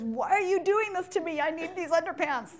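put-on frantic child pleading for his underpants; high-strung kid. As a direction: whine it like a jittery over-caffeinated kid. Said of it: "a very high-strung child".

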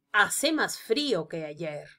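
ordinary talk

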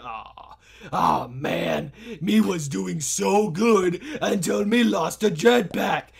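normal talking voice

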